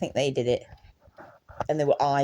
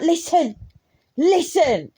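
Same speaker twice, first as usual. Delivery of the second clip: listen, listen, pitched up high and pressing; high urgent plea; 8 semitones higher, 3 dB louder, slower than usual. Retold or imitated. Pressing mum to stop and listen, high and urgent. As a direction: beg her to stop and listen.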